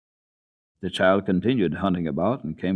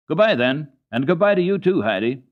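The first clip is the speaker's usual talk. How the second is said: quick, high, loud farewell; hurried goodbye; 8 semitones higher, 7 dB louder than usual. A narrator's reading. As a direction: rush the goodbye and flee. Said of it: "she said quickly".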